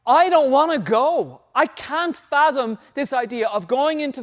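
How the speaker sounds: loud, high, slow pleading; Moses, impassioned plea. Acted. Moses refusing to go on without God, impassioned plea. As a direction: plead forcefully, leaning on each word.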